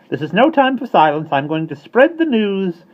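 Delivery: bold starling. Acted. Starling defiant.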